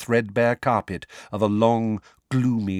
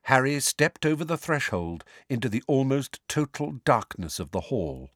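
plain reading voice

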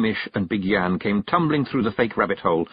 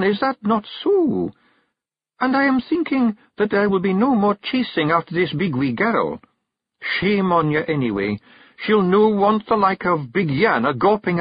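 higher Scottish firm wife; stern Kelda; 10 semitones higher than usual. Acted. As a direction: lay down the law. Jeanie lays down law.

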